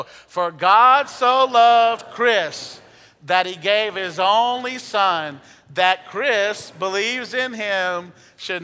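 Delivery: very slow, loud and heartfelt; loud, slow declaration of faith; with feeling. Shown as a demonstration. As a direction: pour belief into every word. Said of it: "let's say it with feeling".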